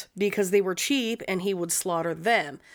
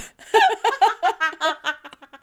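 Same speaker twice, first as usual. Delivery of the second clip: high loud cackling laugh; loud laughter; 9 semitones higher, 8 dB louder than usual. Real, not acted. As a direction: howl with laughter. Big laughter.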